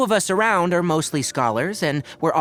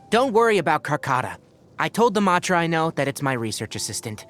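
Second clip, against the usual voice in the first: usual speaking voice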